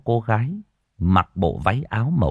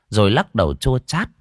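ordinary delivery, nothing marked

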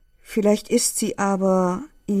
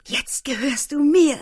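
possessive; 8 semitones higher, 5 dB louder than usual. A narrator's reading. Leni claims K.

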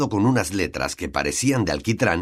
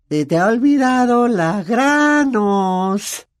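slow, high, gloating taunt; mocking; 5 dB louder, much slower than usual. A narrator's reading. Peeves whispering a cruel nickname, mocking. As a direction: stretch Granos out to sting. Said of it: "le dijo Peeves al oído".

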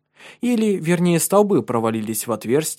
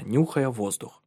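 ordinary delivery, nothing marked

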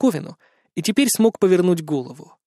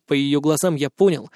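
plain reading voice

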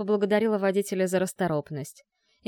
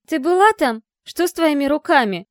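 agitated shouting; 8 semitones higher, 10 dB louder than usual. Acted. Melissa agitated, demanding.

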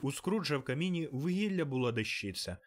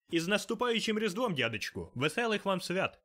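cheerful holiday shout; 8 semitones higher than usual. A narrator's reading. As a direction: burst in calling out good cheer. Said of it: "почувся життєрадісний вигук".

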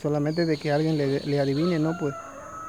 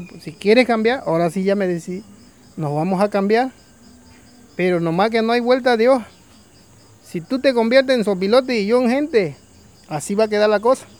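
higher and louder, no turning back; stern warning; 4 semitones higher, 5 dB louder than usual. Acted. Vulture warning farmer the swap is forever, loud and stern. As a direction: lay down the final terms firmly. Vulture finally accepts, warns.

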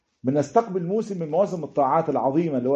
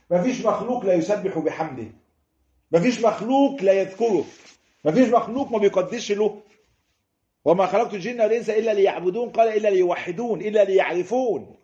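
fervent sermon crescendo; rising preaching fervor; 6 semitones higher than usual. Acted. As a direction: preach it with growing fire. Sermon builds to climax.

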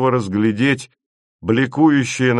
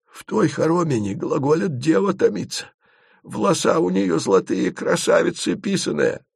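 put-on a lilting, higher old-Russian storyteller; higher folk guide voice; 7 semitones higher than usual. A narrator's reading. Asen tempts the travellers with tales of the maiden. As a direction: tease like a village storyteller. Asen's voice.

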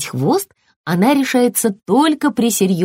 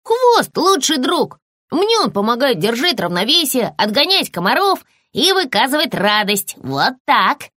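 high, bouncy puppy voice; a happy pup proudly explaining; friendly puppy, cheerful; 6 semitones higher than usual. Acted. Puppy figure held voice.

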